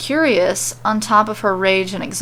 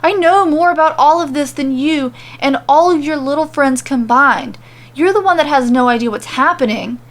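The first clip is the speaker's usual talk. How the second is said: higher, loud ranting; yelling; 6 semitones higher, 4 dB louder than usual. A narrator's reading. Corbin ranting at Mandy, loud and furious. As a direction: keep shouting her down.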